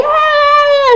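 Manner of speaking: very high, loud girlish mock shriek; panicked mock scream. Women spot the zombie, high hammed-up scream. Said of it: "they're starting to scream and panic".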